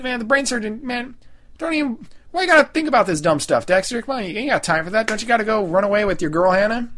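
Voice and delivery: high, exasperated, half-joking talking-to; mock exasperated scolding. Host scolding Dexter on the show, mock exasperated. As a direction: chide the character like a buddy who's being dumb.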